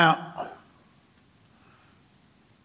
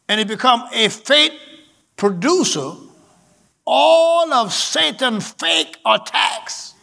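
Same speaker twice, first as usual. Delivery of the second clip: higher-pitched, emphatic sermon delivery; full-force preaching; 4 semitones higher, 3 dB louder than usual. Real, not acted. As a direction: preach it hard, stretching the key phrases.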